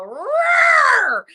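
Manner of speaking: loud, high roaring tiger shout. Retold or imitated. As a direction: roar like a tiger bursting from a cage. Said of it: "a tiger coming out of the cage to go".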